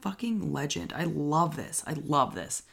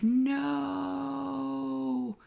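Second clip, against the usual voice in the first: mock no; 12 semitones higher than usual. Dying killer's voice.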